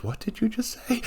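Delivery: high, hushed menacing father; a father catching his son swearing, hushed and stern. Retold or imitated. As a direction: quietly threaten like a shocked dad. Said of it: "when his father goes".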